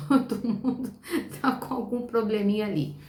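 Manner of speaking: laughing through the words; rindo. Real, not acted. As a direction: break into a real chuckle while speaking. Laughs at everyone in poem ill. (falando entre risos)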